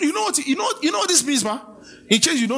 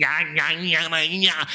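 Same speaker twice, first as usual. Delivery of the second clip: lightly voiced nagging critics, sing-song; mock the talkers with a childish nya nya; a hint of a taunting child; mocking chatter; 7 semitones lower, slower than usual.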